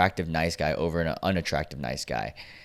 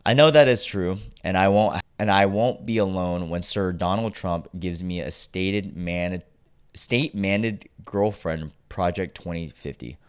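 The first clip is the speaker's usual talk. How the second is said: usual speaking voice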